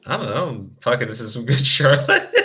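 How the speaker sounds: ordinary delivery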